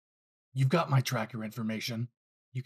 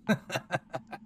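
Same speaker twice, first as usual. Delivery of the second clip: villain laugh; 6 semitones higher than usual. Acted. Villain laughs at the task force.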